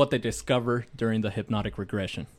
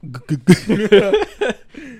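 laughing stutter; 9 semitones higher, 8 dB louder than usual. Real, not acted. Stumbling over word, laughing.

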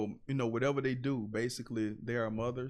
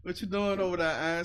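uncle's greeting; 6 semitones higher, 7 dB louder than usual. Retold or imitated. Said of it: "he'll be like".

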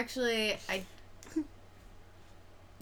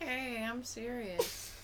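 honking voice; 4 semitones higher, 7 dB quieter, slower than usual. Pretend. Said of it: "I'm gonna talk like a goose".

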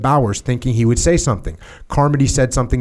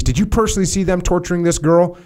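higher-pitched, pressing officer's demand; angry superior; 7 semitones higher than usual. A narrator's reading. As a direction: read the colonel's anger pitched up. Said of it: "immediately began screaming".

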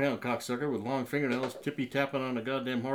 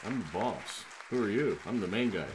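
usual speaking voice

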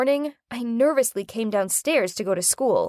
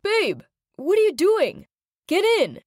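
yelling; 8 semitones higher than usual. Acted. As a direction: yell it to get her attention. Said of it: "yelled at me".

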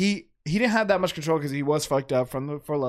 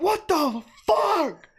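shocked yell; 3 dB louder, much slower than usual. Retold or imitated. Friends retelling Henry grabbing hot glass.

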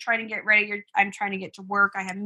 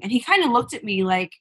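ordinary delivery

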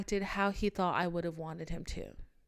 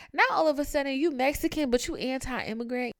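exasperated rant; 5 semitones higher, 6 dB louder than usual. Real, not acted. Continued rant, still raised.

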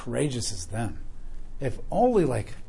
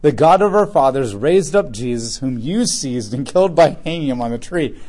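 raised, measured Bible reading voice; scripture reading; 2 semitones higher, 7 dB louder than usual. Acted. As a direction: read the passage out to the church. Reads scripture aloud to congregation.

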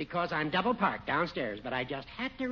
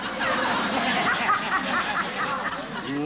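mocking laugh; 9 semitones higher, 3 dB louder than usual. Judge laughs at his own jab.

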